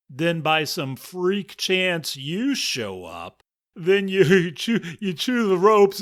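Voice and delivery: cracking up at his own joke; mocking lion. Lion mocking the mouse's offer, laughing loudly.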